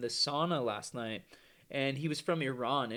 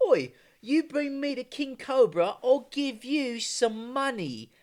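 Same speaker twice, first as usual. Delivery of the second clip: high, loud hammed-up British accent; mock British accent; 10 semitones higher, 5 dB louder, slower than usual. British colonist offering cash for cobras, mock British accent. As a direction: caricature a British colonial, pitched well up. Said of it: "the British, being fucking British, were like".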